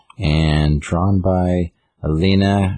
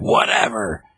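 higher, drawn-out mock retort; Harley Quinn; 6 semitones higher, slower than usual. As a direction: toss it back in a higher, sassy voice. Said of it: "Harley Quinn's like".

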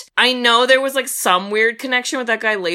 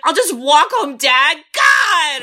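higher, louder, ending in a mock shout; bratty teen outburst; 7 semitones higher, 4 dB louder, slower than usual. Quoting the daughter snapping at her dad, bratty and shouting. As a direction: snap at Dad like a sulky teenager. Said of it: "And she's like".

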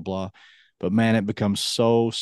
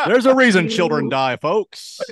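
higher, louder, laughing through it; laughing dark joke; 8 semitones higher, 6 dB louder than usual. Real, not acted.

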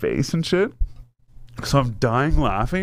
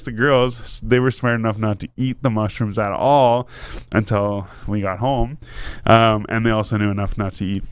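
ordinary delivery

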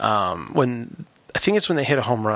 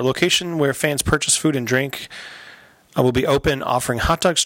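ordinary delivery

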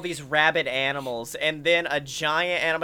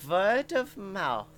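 slow Herzog drawl; 3 semitones higher, slower than usual. Acted. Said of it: "And then he goes".

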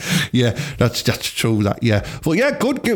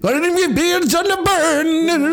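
high, slow, eerie storyteller wail; spooky ghost-story teller; slower than usual. Acted. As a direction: spin it like a ghost story by the campfire. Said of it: "I made him sound like he was telling ghost stories there".

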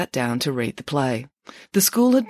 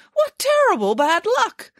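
much higher dramatic cry; dismayed exclaiming. A narrator's reading. Nanny Piggins laments to the children, high and dramatic. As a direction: wail it with theatrical dismay. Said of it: "exclaimed Nanny Piggins".

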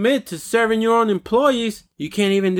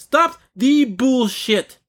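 much higher, very slow and emphatic; escalated angry demand; 6 semitones higher, much slower than usual. Real, not acted. Ranting at companies that sugarcoat, escalated word-by-word demand. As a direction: hammer out each word, drawn out and high.

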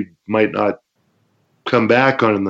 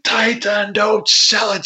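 high-pitched, urgent referee voice; imitated referee; 12 semitones higher, slower than usual. Referee to the kicked wrestler, imitated urgent cry. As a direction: mimic a referee urging a wrestler on. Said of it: "and he's like".

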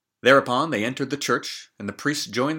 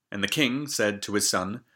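ordinary delivery, nothing marked

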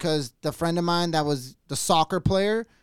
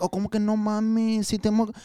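put-on Spanish accent; 4 semitones higher than usual. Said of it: "he would have this accent".

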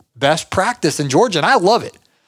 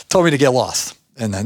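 normal talking voice

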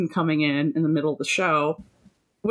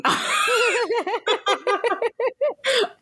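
high-pitched real cackling fit; helpless laughter. Real, not acted. Host cracking up over Paul asking about Rasputin, helpless laughter.

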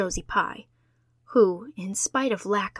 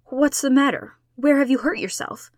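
higher, louder, sharp demand of an adult; 6 semitones higher, 7 dB louder than usual. A narrator's reading. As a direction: insist on an answer at once. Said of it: "demanded Missus Barry".

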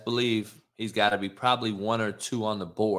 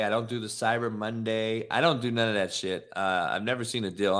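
normal talking voice

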